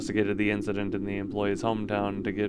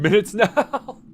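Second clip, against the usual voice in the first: breaks into high laughter; giggling at the tardy employee; laughing; 10 semitones higher, 7 dB louder, slower than usual. Real, not acted. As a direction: laugh through the words. Laughs mid-sentence.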